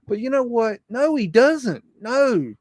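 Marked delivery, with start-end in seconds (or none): none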